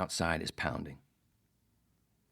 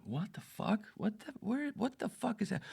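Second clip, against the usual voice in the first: much higher mock voices of baffled people; confused bystanders; 4 dB quieter than usual. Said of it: "everybody's just all around going".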